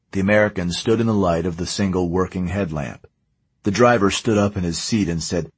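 usual speaking voice

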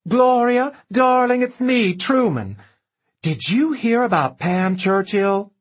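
high, loud, gushing phone voice; gushing camp greeting. Acted. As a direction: gush over the phone.

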